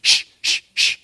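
sharp unvoiced shh; loud shushing. Someone behind the door hushing a barking dog, shushing. Retelling a dog being hushed.

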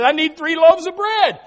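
high, loud demand; mock insistent shout. Neighbour begs bread from sleeping friend, mock insistent shout. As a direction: holler it back through the door. Acted quarrel at the door.

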